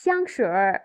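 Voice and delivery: xiangshi ending in a curled, drawn-out r; northern erhua ending. Shown as a demonstration. Teacher showing northern speech, xiangshi finished with a curled r. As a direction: turn the end of xiangshi into a long retroflex r. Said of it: "এভাবে করে ওরা বলে".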